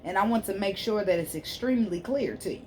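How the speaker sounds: ordinary talk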